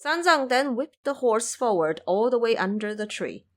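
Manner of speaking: ordinary delivery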